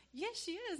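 very high, insistent comeback; retold bickering. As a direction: insist in a mock squabble. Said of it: "we're fighting in front of this girl".

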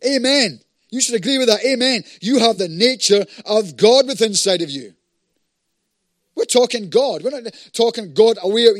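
loud, high call for amens; forceful preaching. Real, not acted. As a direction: preach it loudly to the congregation.

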